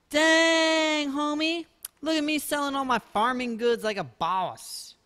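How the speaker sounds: mock boastful homie voice; bragging bro voice. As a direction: show off like a swaggering dude. Bragging about selling goods.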